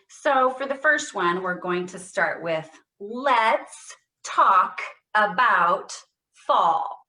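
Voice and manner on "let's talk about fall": slow, loud, every word given equal weight; every word stressed. Shown as a demonstration. Therapist demonstrating to the group, every word stressed. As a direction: hit each word with intention. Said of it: "you heard how clear I was".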